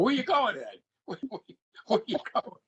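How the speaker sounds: playful mock alarm, pitched high; mock pleading. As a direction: call after him, teasing and high. Teasing Ed as he leaves.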